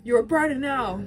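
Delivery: cussing out Dina. Retold or imitated. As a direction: throw it at her as an insult. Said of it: "cusses out Dina".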